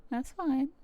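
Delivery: words pushed out on a burp; burping while talking. Real, not acted. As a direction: talk through a burp. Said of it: "Excuse me, I'm burping".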